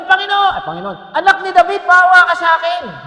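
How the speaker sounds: high, loud desperate plea; crying out. Retold or imitated. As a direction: call out over a crowd, begging.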